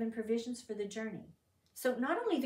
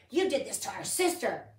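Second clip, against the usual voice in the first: mock angry accusation, louder; retold angry quote; 8 dB louder than usual. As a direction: voice the brothers' hotheaded anger, pushed out loud. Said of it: "in their anger".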